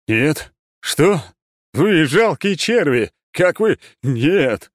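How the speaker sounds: furious roaring monster, slow and loud; ancient lich voice. Acted. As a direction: bellow in outraged disbelief. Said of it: "орал Корус". (яростный рёв древнего лича)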